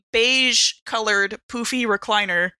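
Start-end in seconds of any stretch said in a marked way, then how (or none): none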